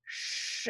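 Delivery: voiceless rushing "sh-"; SH sound. Shown as a demonstration. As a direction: blow "sh-" through rounded lips. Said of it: "round your lips and blow".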